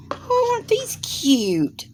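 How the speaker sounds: delighted whisper. Real, not acted. Unboxing gifts, gushing over slippers.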